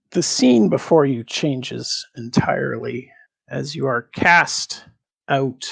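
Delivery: ordinary delivery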